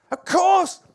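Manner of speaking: high, loud acted outburst; loud exclamation. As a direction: burst out with it emphatically. Acted reaction to being worshipped.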